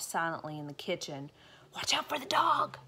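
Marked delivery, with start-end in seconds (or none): 1.8-2.8 higher wary burglar. Acted. Burglar warning his partner, higher wary voice. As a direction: warn your partner about the dog. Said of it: "said one of the burglars".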